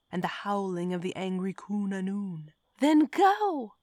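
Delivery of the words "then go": high, loud command; ordered. A narrator's reading. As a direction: bark it to drive him out. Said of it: "Lacey ordered".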